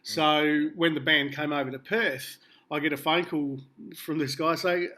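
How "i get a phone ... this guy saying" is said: ordinary talk, nothing marked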